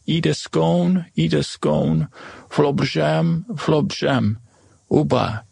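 mock ogre language. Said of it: "that means extra butter".

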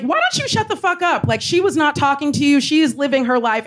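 high, heated rebuke easing as it goes; indignant telling-off. Retold or imitated. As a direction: re-enact confronting a heckler on someone's behalf. Retold confrontation with the heckler.

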